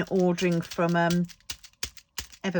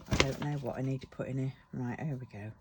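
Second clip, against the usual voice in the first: ordinary talk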